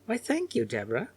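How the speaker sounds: a hint of a genteel elderly woman; lightly voiced old lady. A narrator's reading. Elderly sitter thanking the girl, lightly voiced. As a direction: lift the "Why" high, then settle.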